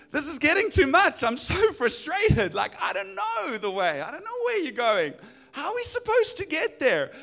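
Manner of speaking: at his wits' end; frustrated Thomas. Retold or imitated. Thomas, lost and frustrated, pleads with Jesus. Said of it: "moment of frustration".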